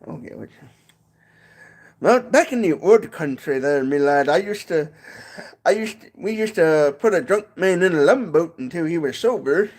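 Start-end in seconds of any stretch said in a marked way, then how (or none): 2.0-9.7 mock Irish old-timer telling tales; Irish accent. Vlogger telling an old-country tale in mock Irish. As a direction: spin a yarn in a jaunty Irish brogue. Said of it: "do a little bit of an Irish accent".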